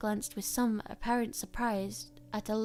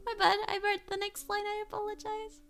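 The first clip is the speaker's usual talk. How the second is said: high, giggly aside about the slip; laughing apology; 11 semitones higher than usual. Real, not acted. Reader laughs at own slip.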